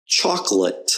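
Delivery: "chocolate" as CHOK-lut, middle O swallowed; schwa in chocolate. Shown as a demonstration. Teacher modelling a practice word, "chocolate" as CHOK-lut. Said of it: "Another word for you to practice".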